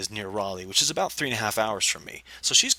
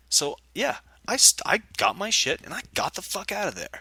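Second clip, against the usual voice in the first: ordinary talk, nothing marked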